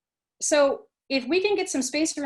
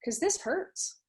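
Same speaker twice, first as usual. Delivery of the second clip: soft, higher, tender self-talk; kind to myself; 5 semitones higher, 5 dB quieter than usual. Pretend. Teacher modelling soothing self-compassion for a hurting student, softly. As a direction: speak gently to your own pain. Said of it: "to ask the question".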